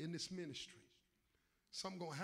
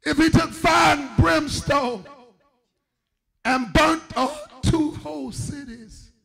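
emphatic preaching; 5 semitones higher, 25 dB louder than usual. Real, not acted. Preacher warning the church of fire and brimstone, emphatic preaching. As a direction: hammer out each phrase with pauses between. Preaching swells over quiet talk.

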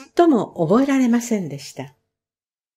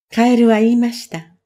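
plain reading voice

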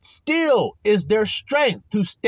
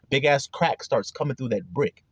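ordinary talk, nothing marked

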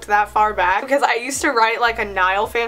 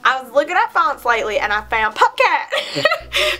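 hammed-up southern accent, cracking up; 2 semitones higher than usual. Shown as a demonstration. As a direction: put on a southern accent while fighting laughter. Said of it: "say it in a southern accent".

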